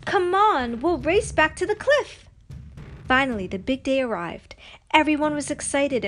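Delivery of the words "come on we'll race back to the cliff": higher and louder eager shout. A narrator's reading. Girl shouting to her friend while paddling ahead. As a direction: call back to her friend as she pulls ahead. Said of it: "Pocahontas shouted".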